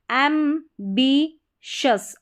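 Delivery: "एम्बिशस" drawn out slowly with a "श" sound; slow model word. Shown as a demonstration. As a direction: stretch "एम्बिशस", the ti said as "श". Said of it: "इसको श पढ़ा जा रहा है".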